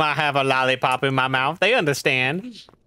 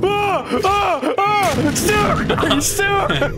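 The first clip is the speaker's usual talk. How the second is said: panicked high-pitched screams; scared screaming; 4 dB louder, much slower than usual. Real, not acted. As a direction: shriek in sudden fright. Friend startled by jump scare.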